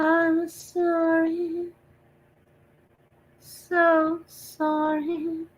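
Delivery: high, very slow eerie wail; spooky ghost voice. Pretend. Streamer spooking chat with a slow ghostly wail. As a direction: drag each word out like a haunting ghost. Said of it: "now I'm being silly".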